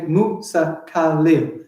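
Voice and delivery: slow "Musa Khalil" in four separate beats; even, deliberate beats on "Musa Khalil"; syllable by syllable. Shown as a demonstration. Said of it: "Four syllables".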